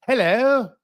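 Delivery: a put-on British greeting; British accent. Shown as a demonstration. Demonstrating a typical British accent to listeners, British accent. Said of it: "The typical British accent".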